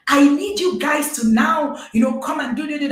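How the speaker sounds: a touch of a hotel guest's annoyance; retold upset demand. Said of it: "I was upset".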